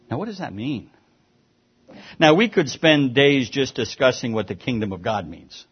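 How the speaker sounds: usual speaking voice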